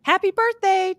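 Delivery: imitating a caller's happy greeting; imitated birthday greeting. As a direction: chirp it like a well-wisher. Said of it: "kept being like".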